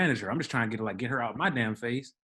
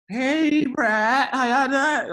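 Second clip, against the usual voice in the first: mock drunk resident, much higher and louder; drunk woman; 13 semitones higher, 8 dB louder, much slower than usual. Drunk resident greeting the concierge, mocked high and loud. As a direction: ham up a tipsy woman gushing hello. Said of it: "she's trash".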